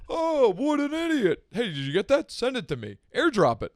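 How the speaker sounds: loud, high-pitched mimicry of a bystander; exaggerated loud gawker; mock tourist onlooker. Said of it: "someone free, from Brooklyn wouldn't be like".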